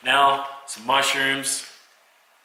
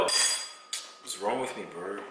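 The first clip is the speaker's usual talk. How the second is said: frustrated self-mutter; 3 semitones lower, 9 dB quieter, much slower than usual. Real, not acted. Frustrated at forgotten water.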